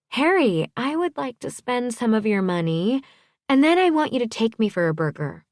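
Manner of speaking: high-pitched character voice, wheedling; playfully demanding woman. A narrator's reading. Woman telling Harry to spend money on her, playfully demanding. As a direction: announce your wishes in a high, lifted voice.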